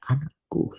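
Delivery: soft, hushed, near breaking; trembling tender call. Acted. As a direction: whisper it as if moved to tears. (panggilan lembut yang bergetar)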